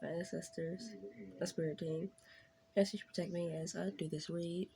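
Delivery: soft, hushed prayer; reverent, softly spoken invocation; opening prayer. Acted. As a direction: pray quietly before the reading. Opening prayer before tarot read.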